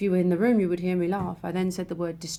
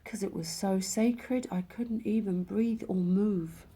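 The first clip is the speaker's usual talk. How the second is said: whisper; 3 dB quieter, slower than usual. Real, not acted. Awestruck by the sacred vision.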